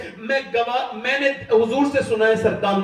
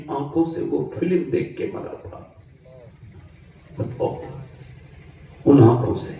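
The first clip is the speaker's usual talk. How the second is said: low, searching preacher's voice; hushed sermon; 8 semitones lower than usual. Acted. Low, slow intimate questioning.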